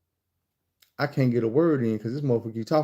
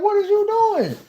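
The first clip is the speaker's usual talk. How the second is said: much higher, louder alarmed cry; panicked yell at her barging into the bathroom; shocked shriek; 4 dB louder than usual. Retold or imitated. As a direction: shriek at the intruder. She barges in.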